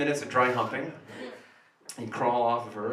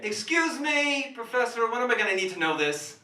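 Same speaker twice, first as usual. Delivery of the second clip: whiny student; 9 semitones higher than usual. Acted. Student heckling the professor.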